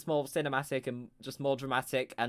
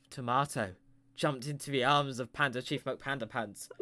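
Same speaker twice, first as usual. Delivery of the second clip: ordinary talk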